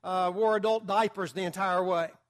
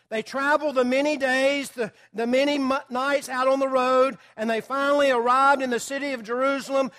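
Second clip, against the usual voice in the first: raised, louder preaching voice building conviction; fervent preaching; 4 semitones higher, 4 dB louder than usual. Acted. Pastor stirring the church about the wise men's faith, fervent preaching. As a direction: press the point home to the congregation.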